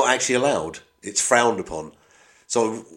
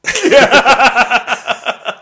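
real laugh; 12 semitones higher, 7 dB louder than usual. Real, not acted. Laughing at own accent.

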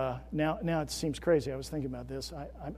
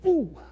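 mock ooh; 11 semitones higher, 6 dB louder than usual. Reacting to his own point.

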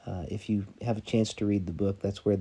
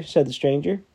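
measured storytelling read, dramatic pauses; sermon story read aloud with quoted voices; story narration; 3 semitones higher, 5 dB louder than usual. A narrator's reading. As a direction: read it like a parable to the church. Pastor reads story aloud to congregation.

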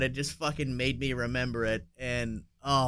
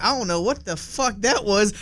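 higher voice, baffled and put-on; confused realtor; 7 semitones higher than usual. Realtor looking around the office, higher mock confusion. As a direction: act out the lost realtor. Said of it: "looking around like".

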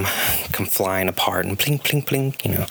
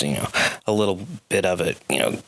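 usual speaking voice

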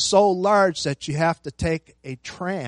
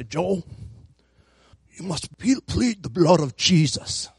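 put-on light South African accent; a hint of a South African friend. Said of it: "I don't have a good South African accent".

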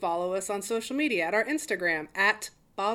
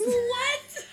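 surprised. Real, not acted. Surprised at the TikTok dance.

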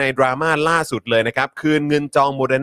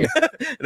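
laugh; 11 semitones higher, 4 dB quieter than usual. Real, not acted. Laughing at own joke.

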